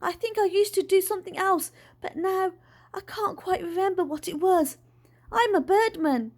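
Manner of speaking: high-pitched, insistent retort; snapping. A narrator's reading. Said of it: "snapped Dad".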